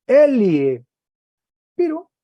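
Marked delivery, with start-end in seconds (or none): 0.0-0.8 "Elie" loud, slightly higher; teacher reads the form aloud; model reading. Shown as a demonstration. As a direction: proclaim "Elie" clearly. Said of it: "¿Cómo se lee?".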